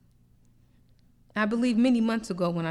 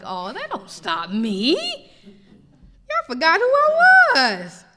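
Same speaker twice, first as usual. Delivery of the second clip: slow, booming divine voice rising to a shout; God laughing off their stubbornness, slow and loud; God speaking; 5 semitones higher, 8 dB louder, slower than usual. Acted. God answers stubborn people, slow, rising to a shout. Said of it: "He's like".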